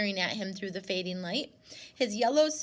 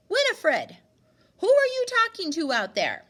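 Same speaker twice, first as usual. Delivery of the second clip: loud, higher call across the yard; 10 semitones higher, 10 dB louder than usual. Acted. Grandmother calling Winifred from the cottage door, loud call.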